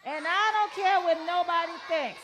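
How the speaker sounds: loud, high shouted chant; outside voice. Real, not acted. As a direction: shout it out proudly. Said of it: "Say it again".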